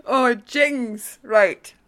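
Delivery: laughing, pulling herself together; giggly recovery. Real, not acted. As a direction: regain control through laughs. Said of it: "Stop it".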